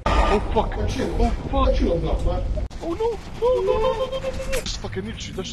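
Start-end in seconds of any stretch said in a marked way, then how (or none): 0.1-3.1 alarmed shout. Real, not acted. Sudden danger in game. 3.4-4.6 very high panicked no no no; panicked squeal. Real, not acted. Panic as enemy attacks.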